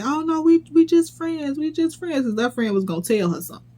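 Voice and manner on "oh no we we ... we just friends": high-pitched mimicry of a woman denying it; imitated "just friends" excuse, pitched up; mock innocent denial. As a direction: put on a higher, fake-innocent protest. Mimicking her lying denial.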